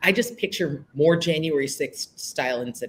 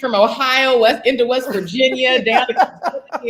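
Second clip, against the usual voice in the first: laughing through the words; chuckling, high and loud; 8 semitones higher, 6 dB louder than usual. Real, not acted. Laughing about the story.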